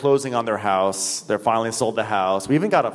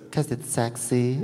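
put-on slow teen boy; 4 dB quieter than usual. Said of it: "And he goes".

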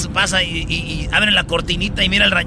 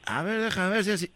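muttering; 2 semitones lower, 10 dB quieter than usual. Acted. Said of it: "y dice".

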